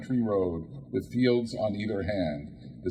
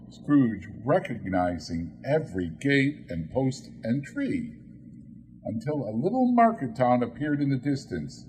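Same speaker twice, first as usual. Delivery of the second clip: usual speaking voice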